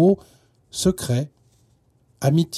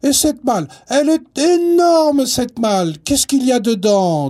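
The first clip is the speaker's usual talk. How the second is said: put-on loud, amazed child; bursting with curiosity; excited; 9 semitones higher, 6 dB louder than usual. Child amazed at a huge trunk.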